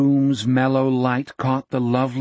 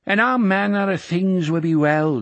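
lightly voiced old man; 6 semitones higher than usual. A narrator's reading. Jack echoes old man.

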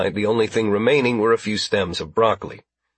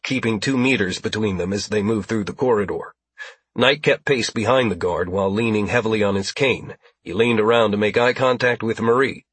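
ordinary delivery

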